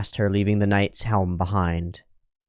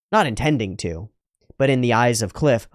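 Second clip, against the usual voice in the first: ordinary delivery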